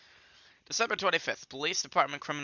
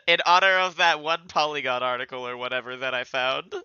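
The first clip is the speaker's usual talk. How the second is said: normal talking voice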